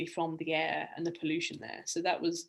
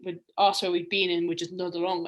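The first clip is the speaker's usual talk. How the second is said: ordinary talk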